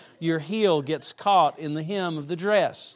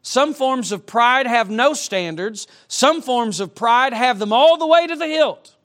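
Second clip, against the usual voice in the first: loud, slow pulpit proclamation; fiery sermon opening; preaching; 6 semitones higher, 7 dB louder than usual. Acted. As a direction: thunder it from the pulpit.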